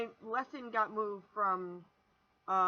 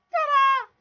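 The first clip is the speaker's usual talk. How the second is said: big, high, loud ta-da; excited reveal; 17 dB louder than usual. Real, not acted. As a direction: announce the reveal like a magician. Unveiling the new bass guitar.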